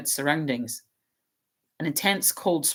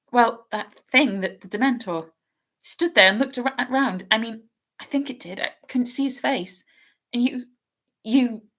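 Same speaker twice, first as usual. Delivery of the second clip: lightly voiced schoolgirl, higher and hurried in distress; a nervous young girl stumbling over her words; anxious girl's voice; 7 semitones higher, 3 dB louder than usual. Hermione's voice in reading.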